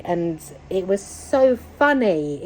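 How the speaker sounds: normal talking voice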